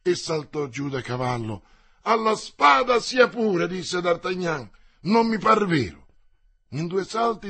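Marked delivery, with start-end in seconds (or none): 2.0-3.7 louder and higher, eager shout; shouted acceptance. Acted. D'Artagnan accepts the duel. 5.0-6.1 eager cry. Acted. D'Artagnan eager for duel.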